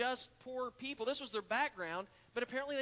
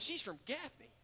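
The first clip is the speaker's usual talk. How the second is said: imitated Gaffney accent, pitched higher; 6 semitones higher, 3 dB quieter than usual. Church lady correcting where the actress is from, Gaffney accent. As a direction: mimic her Gaffney, South Carolina accent. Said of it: "said it in her Gaffney accent".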